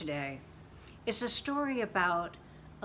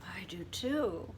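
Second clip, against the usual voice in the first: ordinary delivery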